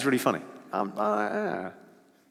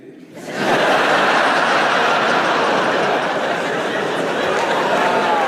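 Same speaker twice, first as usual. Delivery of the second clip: breathy cackle; 2 semitones higher, 4 dB louder than usual. Real, not acted. Comedian cracking up after his Aussie impression, breathy cackle. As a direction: wheeze out a cackle.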